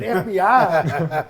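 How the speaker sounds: slow, stretched disbelief with a laugh; incredulous. Retold or imitated. Retelling the FBI news, slow laughing disbelief. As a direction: drag it out like you can't believe it.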